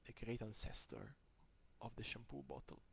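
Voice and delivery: soft, reverent near-murmur; hushed awe. Retold or imitated. Looking at a shampoo bottle in revelation, hushed awe. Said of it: "I look at it and I'm like".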